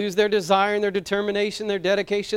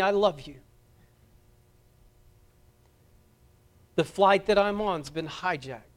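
softer and lower, calmly reassuring; calm assurance; 3 semitones lower, 3 dB quieter than usual. Retold or imitated. Passenger calming his wife on the phone, soft and calm. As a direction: gently tell a loved one goodbye. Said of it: "very calmly said".

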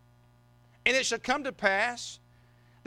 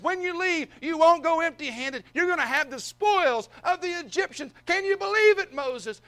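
high, loud and thrilled; bursting with excitement over the plan; excited Lord; 9 semitones higher, 5 dB louder than usual. Acted. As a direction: build to an exultant, amazed cry. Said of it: "excitement in the Lord's voice as he's talking to him".

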